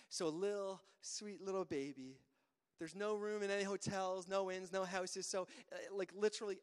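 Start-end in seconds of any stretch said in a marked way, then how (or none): none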